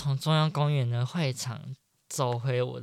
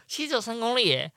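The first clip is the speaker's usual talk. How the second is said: higher, laughing at 只; laughing disbelief; 8 semitones higher than usual. Shown as a demonstration. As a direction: repeat it, laughing at yourself. (笑着说“其实只有三公里耶”)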